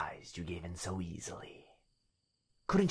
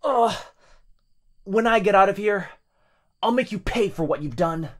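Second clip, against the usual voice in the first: higher, much louder furious threat; vengeful boyfriend; 12 semitones higher, 13 dB louder than usual. Acted. Trapped boyfriend threatening the demon, furious shout. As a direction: swear revenge through gritted teeth.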